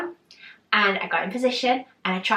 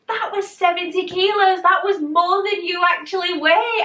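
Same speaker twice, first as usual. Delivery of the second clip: high-pitched, thrilled announcement; excited reveal; 10 semitones higher, 4 dB louder than usual. Retold or imitated. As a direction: re-enact a coach's excited reveal. Trainer's excited reveal, retold.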